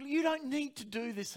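high-pitched protest of the flesh. Retold or imitated. Preacher's flesh argues against the fast, high and insistent. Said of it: "this voice was getting louder and louder".

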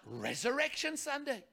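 normal talking voice

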